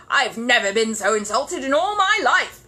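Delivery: loud, high outraged cry; insulted. Acted. Curry to the party, loud outraged cry. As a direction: wail the insult in fury.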